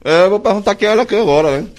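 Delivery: talking with mouth full of food. Real, not acted. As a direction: mouth full of goop, words muffled. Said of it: "Engula primeiro, depois você me fala".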